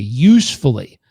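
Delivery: ordinary talk, nothing marked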